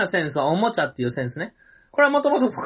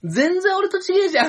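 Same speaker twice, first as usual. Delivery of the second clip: high-pitched comic protest; mock outraged surprise; 10 semitones higher than usual. Comic reacting to the photo shown by partner, mock outrage. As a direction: protest in hammed-up disbelief.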